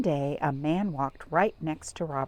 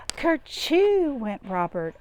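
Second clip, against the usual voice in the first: sneeze; 5 semitones higher, 3 dB louder, slower than usual. Acted. Robert's big sneeze.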